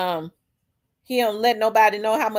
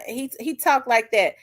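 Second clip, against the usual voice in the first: ordinary talk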